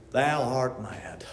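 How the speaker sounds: low, hushed and slow; soft, low dismissal; quiet verdict. Acted. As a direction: say it slowly under your breath. Deadpan take on the Bible quote.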